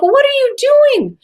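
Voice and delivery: very high, loud retold disbelief; incredulous self-questioning. Witness questioning herself on leaving the car, high-pitched incredulity. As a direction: squeal it at yourself in mock alarm. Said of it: "this conversation with myself".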